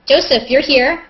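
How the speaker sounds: ordinary delivery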